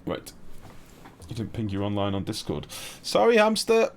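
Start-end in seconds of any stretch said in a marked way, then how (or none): 3.0-3.9 squeaky high, loud sorry; higher, louder mock apology to the viewer. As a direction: play at being sorry in a high voice.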